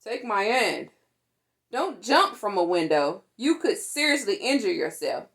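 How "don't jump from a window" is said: plain reading voice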